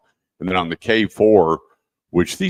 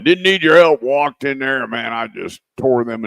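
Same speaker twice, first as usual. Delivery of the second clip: mocking know-it-all; 5 semitones higher, 4 dB louder than usual. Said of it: "And he told me".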